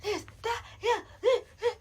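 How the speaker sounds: high mock chatter of a rowdy viewer; mock yelling viewer. Partygoers chattering over the show, high mock chatter. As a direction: toss out excited fan babble. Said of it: "and you're like".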